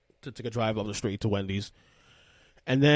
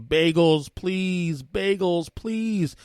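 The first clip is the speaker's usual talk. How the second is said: imitated screaming child; 6 semitones higher, much slower than usual. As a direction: chant it like a nagging child. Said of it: "he kept shouting the, the entire ride, screaming".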